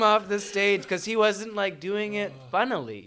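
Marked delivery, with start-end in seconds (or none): none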